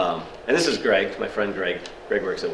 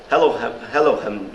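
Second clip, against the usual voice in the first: mock English switchboard greeting; bad English accent; 2 semitones higher, 3 dB louder than usual. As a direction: put on a hammed-up English hello. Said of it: "do a bad English accent".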